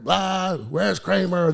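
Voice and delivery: half-voiced retold yelling; mock heckler yell. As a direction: imitate a drunk crowd yelling out. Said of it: "they're just yelling".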